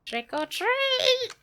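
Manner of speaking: high, slow, playful sing-song; sing-song greeting. Real, not acted. Greeting new follower on stream.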